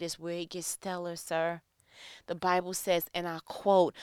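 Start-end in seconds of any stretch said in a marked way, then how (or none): none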